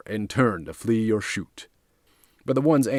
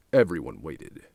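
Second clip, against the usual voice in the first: ordinary delivery, nothing marked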